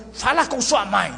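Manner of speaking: put-on miffed parent deflecting the child; mock-cross "go ask your mother" voice; quarrelling father's brush-off. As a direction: act a sulky father passing the child along. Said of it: "Quando você briga assim".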